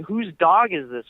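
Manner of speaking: a light retold yell.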